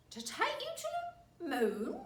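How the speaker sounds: high, loud mock surprise with a laugh; mocking cry as Mr Twit. A narrator's reading. Husband mocking his wife's fear of the moon. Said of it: "cried Mister Twit".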